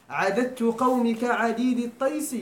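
slow, measured reading of the verse; drawn-out teaching recital; slow recitation. Shown as a demonstration. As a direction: recite it word by word for the class. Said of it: "teacher recites verse line".

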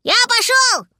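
put-on very high childlike cry; excited shout. A narrator's reading. Little dragon jumping off the branch, high excited cry. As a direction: call out as you launch. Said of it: "крикнул гусля".

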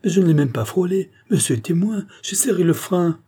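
high-pitched, indignant self-defence; yelled protest. A narrator's reading. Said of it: "hurla le watman". (protestation criée, indignée)

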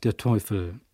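usual speaking voice

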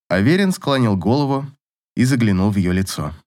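ordinary delivery

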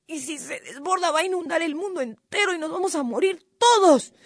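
high-pitched panicky moaning; hammed-up desperate pleading; straining little cuy. A narrator's reading. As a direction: wail and groan as if holding a huge weight. Cuy feigns straining under the stone.

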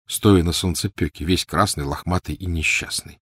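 ordinary delivery

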